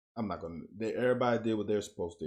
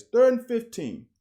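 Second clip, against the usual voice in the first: much higher, louder, third stressed hard; exasperated outburst; 11 semitones higher, 8 dB louder than usual. Real, not acted. Coach reviewing film blurts out the down, exasperated shout. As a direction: cry out the down in disbelief.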